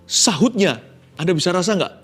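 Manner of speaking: ordinary talk, nothing marked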